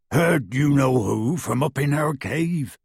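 slow, grim giant voice; grimly. A narrator's reading. Hagrid tells Harry why he came, slow and grim. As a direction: report it darkly. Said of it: "said Hagrid grimly".

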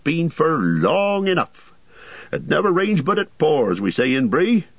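high, chatty landlord voice; an overworked host rattling on; bustling innkeeper. A narrator's reading. Butterbur rambles on.

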